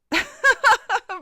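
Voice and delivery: high burst of laughter; big cackle at own joke; loud laugh. Real, not acted.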